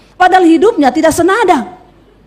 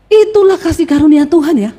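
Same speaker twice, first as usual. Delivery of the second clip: ordinary delivery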